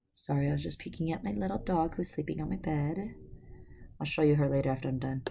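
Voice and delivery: plain reading voice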